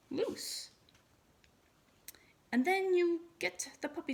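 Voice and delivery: put-on a mother teaching her boy step by step; patient explaining mother. A narrator's reading. Mother instructing her son.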